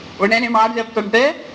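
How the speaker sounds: usual speaking voice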